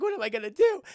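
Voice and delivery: high, loud imitated wail; hammed-up helpless cry; mock despair. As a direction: wail it up high like a panicking girl. Acted daughter despairing over food binge.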